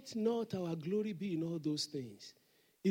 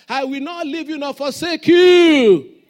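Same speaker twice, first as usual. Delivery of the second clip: much louder, slow and high; shouted promise; 7 semitones higher, 20 dB louder, slower than usual. Real, not acted. Preacher shouting the verse to the congregation, loud and slow. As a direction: cry it out to the whole room.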